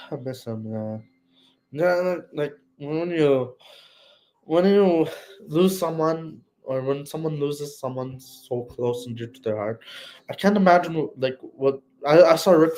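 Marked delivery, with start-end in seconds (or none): none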